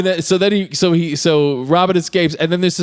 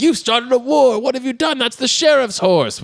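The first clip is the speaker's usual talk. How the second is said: high-pitched mock outrage; hammed-up dismayed villagers; alarmed scolding; 10 semitones higher than usual. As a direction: pitch it up as a panicked telling-off. Retold outlaws scolding Robin.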